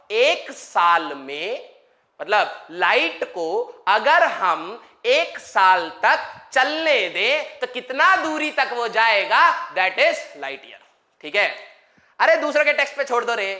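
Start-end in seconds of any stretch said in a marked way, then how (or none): 0.1-9.7 drawn-out Hindi explanation; slow it right down for the class; very slowly. Shown as a demonstration. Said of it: "बहुत धीरे धीरे बोल रहा हूं". (बहुत धीरे धीरे)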